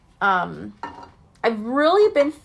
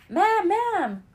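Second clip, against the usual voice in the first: mild mock scream; 9 semitones higher than usual. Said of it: "Now he's screaming".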